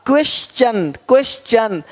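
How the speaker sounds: "क्वेश्चन" as kwes-chan, repeated; "क्वेश्चन" with a clear ch, falling. Shown as a demonstration. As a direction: say "क्वेश्चन" with ch after s. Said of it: "टी का उच्चारण चा होता है".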